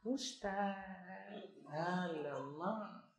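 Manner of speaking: soft, drawn-out mock coy voice; limp-necked coy student. Nursing student coyly sighing at the ustaz in a soft drawn-out voice. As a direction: play a girl going limp with shyness. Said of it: "buat lembik tengkuk".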